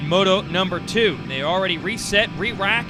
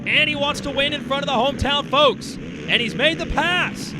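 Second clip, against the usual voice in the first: motorsport commentator. Acted. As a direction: call the winning pass. Live race call of the pass.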